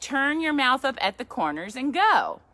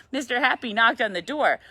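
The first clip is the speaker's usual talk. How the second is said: usual speaking voice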